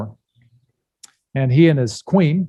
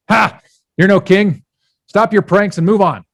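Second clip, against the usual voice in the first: raised, loud mock scolding; scornful dismissal; 7 semitones higher, 6 dB louder than usual. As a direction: send a prankster away from the door. Disbelieving householder dismisses the king.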